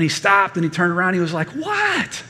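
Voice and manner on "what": very high mock child's "what"; indignant kid. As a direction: imitate a child snapping back as if grandpa is stupid. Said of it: "like I was stupid".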